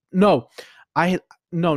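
ordinary delivery

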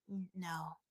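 very quiet, low, hesitant no; reluctant refusal. Retold or imitated. Girlfriend asked to speak Korean, reluctant and hushed. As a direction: shrink away on a hushed no.

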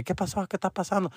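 high, very soft bystander voices; murmuring onlookers. Retold or imitated. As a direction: imitate a curious crowd in small voices. Voicing onlookers asking what happened.

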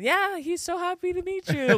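high-pitched fake-gracious mom; imitated mom covering with a lie; lying mom. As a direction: play along in a high, put-on voice. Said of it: "my mom starts lying".

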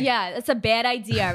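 put-on Staten Island accent; New York accent. Guest playing along with added R, New York accent. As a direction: idea ending in R, New York style. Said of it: "she puts the R at the end".

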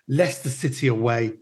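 ordinary delivery

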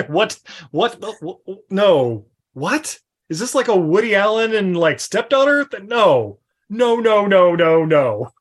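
loud, stunned repeated denials; can't believe what you just heard; shocked disbelief. Real, not acted. Reacting to banging reveal.

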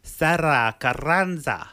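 Sarah Carranza slow, r's rolled; heavy rolled r. Shown as a demonstration. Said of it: "I tried to say it like you say it".

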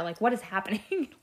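normal talking voice

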